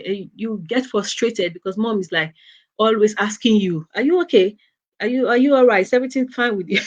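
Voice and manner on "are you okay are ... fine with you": higher, louder fussing mom's questions; pestering mom. Retold or imitated. Mom fussing over her moody teen daughter, higher and louder. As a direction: nag like an anxious mother, pitched up and loud. Mom repeatedly asking if okay.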